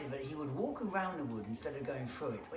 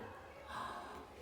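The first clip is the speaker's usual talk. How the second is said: quick breathless gasp; startled gasp; sharp gasp; 9 dB quieter than usual. Acted. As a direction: catch your breath in alarm. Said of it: "Oh dear".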